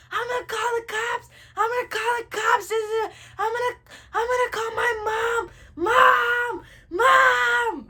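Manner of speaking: high wailed threats building to loud screams; screaming head off. Retold or imitated. Child threatening grandma with cops, hammed-up screaming. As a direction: cry out threats, then scream for mom. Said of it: "I'm screaming my head off".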